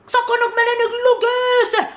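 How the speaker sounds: high, fast stern scolding. Retold or imitated. Elder scolding the family in a high, fast voice.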